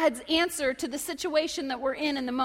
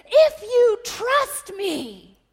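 high, loud, long-drawn proclamation; shouting to crowd; 8 semitones higher, 9 dB louder, much slower than usual. Acted. Jesus calling to the crowd, drawn-out shout. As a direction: call it out slowly across a crowd. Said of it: "Jesus shouts to the crowd".

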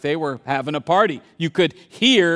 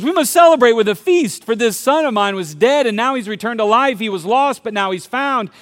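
a louder, higher joyful father calling out; 5 semitones higher, 6 dB louder than usual. Acted. Father announcing the feast to his household, joyful and loud. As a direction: proclaim the feast with delight.

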